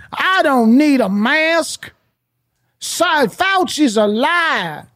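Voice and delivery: slow, drawled Southern loudmouth caricature; mock an anti-masker in a thick Southern accent. Speaker mimics an anti-masker refusing masks, Southern accent. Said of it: "every asshole has a Southern accent".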